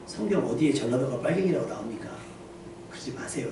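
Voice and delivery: hushed, breathy whisper fading to no voice; whispered in the ear. Retold or imitated. Pastor whispering a rebuke into the elder's ear, hushed. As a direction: drop to a secret whisper. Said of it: "귀에다 대고".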